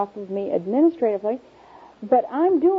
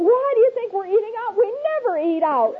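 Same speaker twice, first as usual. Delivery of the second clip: higher, quick sarcastic retort; exasperated snap at husband; 9 semitones higher than usual. Pretend. Wife snapping at husband asking about dinner, quick and higher. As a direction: fire back, irritated at the question. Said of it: "he says".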